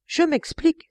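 high, bright burst of realization; sudden exclamation. A narrator's reading. Innkeeper suddenly remembering, exclaiming high. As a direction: cry out as the memory hits. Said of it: "s'écria-t-elle".

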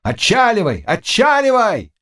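rising urgency in a high shout; crowd shouting. A narrator's reading. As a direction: holler an order to cast off. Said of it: "кричали и те".

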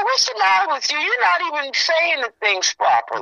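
high exasperated scold; telling him off, voice way up; retold scolding. Scolding the MC at funeral.

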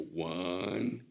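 mock the parent who keeps stalling; stalling parent count. Mocking parents who stretch the count, slow, rising and loud. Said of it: "like parents go".

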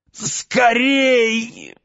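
high, strained last cry; desperate cry. A narrator's reading. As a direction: force out a final cry. Dying man's last cry.

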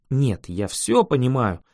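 ordinary delivery, nothing marked

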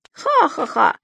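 high teasing cackle; mocking laugh. A narrator's reading. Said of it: "рассмеялся Субастик".

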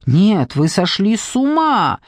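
incredulous cry, rising sharply on the question; appalled outburst; shocked exclamation. A narrator's reading. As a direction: exclaim in disbelief, drawing out the protest. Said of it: "воскликнула Дженни".

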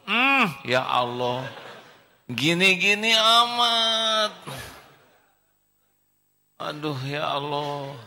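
high, slow, drawn-out sigh to God; sad lament. Acted. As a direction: plead slowly, heartbroken. Boy complains to God. (mengeluh sedih dan pelan kepada Allah)

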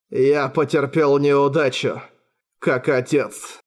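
slow, resigned and sad; resigned sorrow. A narrator's reading. Father admitting failure, slow and resigned. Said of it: "печальная, смиренная улыбка".

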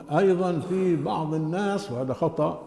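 normal talking voice

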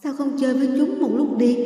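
high, soft mocking ghost girl; eerie ghost voice. A narrator's reading. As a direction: coo like a taunting spirit. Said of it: "Giọng nói ma quái của cô gái".

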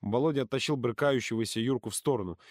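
usual speaking voice

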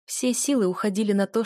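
ordinary delivery

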